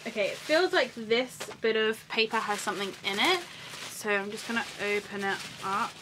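ordinary talk, nothing marked

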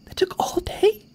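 high, slow incredulous whisper fade. Real, not acted. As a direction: disbelief at lost day. Festival took whole day.

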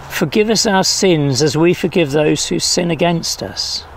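ordinary delivery

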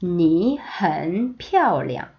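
very slow, each syllable drawn out; slow choral reading; slow read-along. Shown as a demonstration. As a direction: read slowly with the class. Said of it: "두 번 시작".

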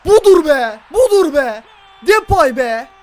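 loud joyful cheer. Real, not acted. Gamer cheering his striker's goal, loud and joyful. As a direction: shout it out in triumph.

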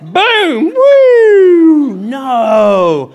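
high loud "woo" sliding down; hammed-up sound effects of getting caught; comic siren and wail. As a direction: imitate a siren, falling into a drawn-out no. Acting out being caught by cop.

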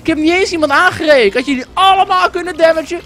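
high-pitched, aggrieved protest; indignant complaint. Real, not acted. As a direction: plead your innocence heatedly. Aggrieved complaint about being attacked.

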